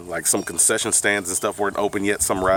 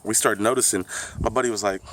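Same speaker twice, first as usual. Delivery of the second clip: ordinary talk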